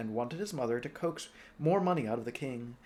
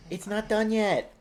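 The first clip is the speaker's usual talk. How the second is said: mock childish whine; 9 semitones higher, 6 dB louder than usual. Stray voice.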